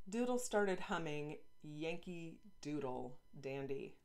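ordinary delivery, nothing marked

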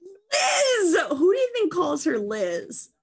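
high, loud cry of disbelief; incredulous outcry. Real, not acted. Objecting to the queen being called Liz, incredulous. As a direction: shriek the name, then stay high and indignant. Mock outrage at the nickname.

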